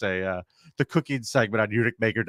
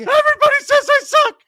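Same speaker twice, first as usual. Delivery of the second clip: whiny tantrum; 11 dB louder than usual. Pretend. Mocking suspect's tantrum.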